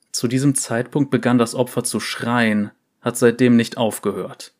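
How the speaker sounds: plain reading voice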